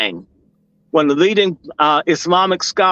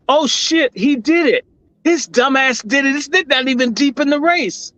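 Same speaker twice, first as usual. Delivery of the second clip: much higher, excited told-you-so; excited exclamation; 8 semitones higher than usual. Retold or imitated. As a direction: burst out as the prediction lands. Prediction comes true, exclaims.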